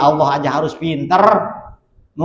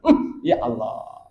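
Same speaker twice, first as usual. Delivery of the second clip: awed prayer; 7 semitones lower, 8 dB quieter, slower than usual. Acted. Prophet sighs in awe.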